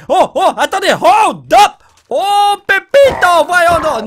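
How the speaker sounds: loud, high exclamation; alarmed. Real, not acted. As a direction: cry out in alarm. Alarm at the shovel.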